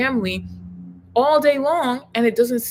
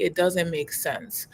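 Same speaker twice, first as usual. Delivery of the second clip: ordinary delivery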